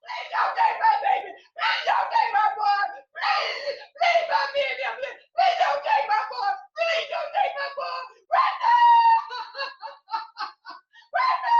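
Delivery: high, loud, sobbing screams for her son; anguished screaming. Acted. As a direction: scream and sob as your child is dragged away. Mother screams as son is taken.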